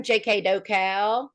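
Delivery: ordinary delivery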